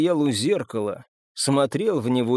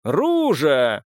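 drawn-out summoning call; 4 dB louder than usual. A narrator's reading. Marcus summoning a woman out of sight, calling out. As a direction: call the name out to someone unseen.

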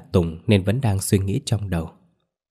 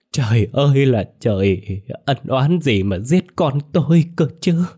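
wailing lament; 5 semitones higher than usual. Acted. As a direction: cry out for a lost son, voice breaking high. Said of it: "mà gào lên". (gào khóc thảm thiết, giọng cao và to)

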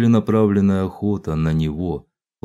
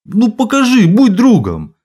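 high, loud whiny plea; mock pleading; 12 semitones higher, 8 dB louder than usual. A narrator's reading. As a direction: beg in a squeaky voice.

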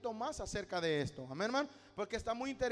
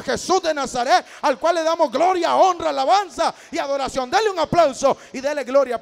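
loud, rising sermon climax, calling out the name of Jesus; fervent evangelical preacher thundering to the congregation; full-force preaching; 12 dB louder than usual. Acted. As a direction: preach it at the top of your voice.

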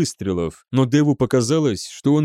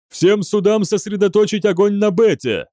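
higher, forceful command; urgent order; 8 semitones higher, 3 dB louder than usual. A narrator's reading. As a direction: order the crew in the heat of battle. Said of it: "приказал Ван Аунгер". (громко отдать боевой приказ)

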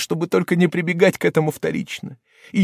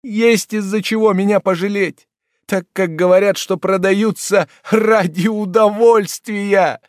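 much higher and louder, desperate; frenzied, high and loud cry of despair; frantic outburst; 9 semitones higher, 5 dB louder than usual. Acted. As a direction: plead wildly to the whole room. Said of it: "в безумном порыве".

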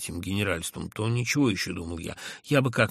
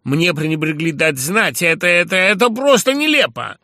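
louder and higher, more and more worked up; growing irritation; 8 semitones higher, 11 dB louder than usual. A narrator's reading. The narrator angrily blames the others, growing irritated. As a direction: build the anger line by line. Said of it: "раздражаясь всё более и более".

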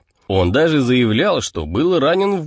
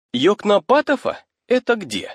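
high puzzled rising question; 8 semitones higher than usual. Pretend. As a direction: ask it baffled, the pitch rising. Puzzled reader asks about the name.

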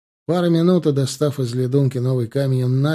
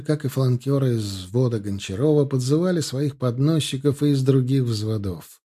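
usual speaking voice

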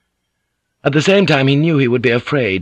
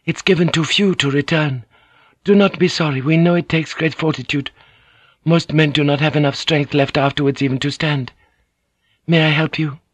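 higher, respectful lady's voice; Mariko voiced; 6 semitones higher than usual. A narrator's reading. Mariko offers the weakened Anjin-san her help, higher and respectful. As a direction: honor him as you offer help.

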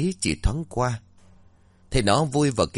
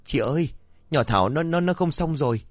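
higher, broken stammer; stammering in fear; 7 semitones higher than usual. A narrator's reading. Girl telling the older sister her friend is dying, stammering. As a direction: get the words out through fright. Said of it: "run rẩy chỉ tay vào trong nhà rồi lắp bắp".

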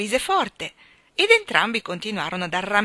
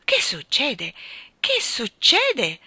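scandalized question, repeated higher and slower; 5 semitones higher, 5 dB louder, much slower than usual. A narrator's reading. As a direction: build from startled to drawn-out outrage. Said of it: "disse il gran cancelliere scandalizzato".

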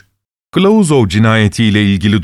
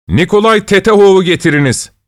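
raised, higher-pitched summons; called-out order; 9 semitones higher than usual. A narrator's reading. Investigating judge orders Nikolay Tetehov brought in, calling out. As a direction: call out the order, mildly shouted. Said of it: "diye bağırdı".

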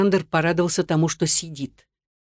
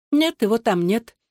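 indifferent; 6 semitones higher than usual. A narrator's reading. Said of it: "равнодушно откликнулась Мунлай".

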